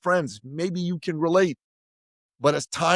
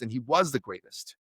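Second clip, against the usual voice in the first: usual speaking voice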